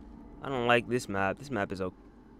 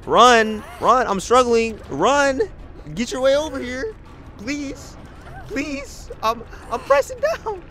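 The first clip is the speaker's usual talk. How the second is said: higher urgent panic; panicked pleading; 9 semitones higher, slower than usual. Real, not acted. Struggling on hook.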